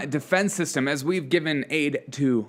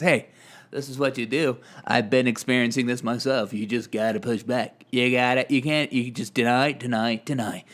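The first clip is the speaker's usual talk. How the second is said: mock insider advice, trailing lower; Chris Cuomo; 3 semitones lower than usual. Imitates Cuomo privately advising his brother.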